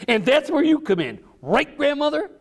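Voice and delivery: put-on high, bright eager boy; excited child asking grandma. A narrator's reading. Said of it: "asked Richard".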